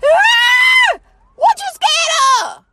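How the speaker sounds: high, loud mock outrage; mock shriek. Mom shrieking at her daughter for being scared, high and loud. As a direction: shriek at the kid in disbelief.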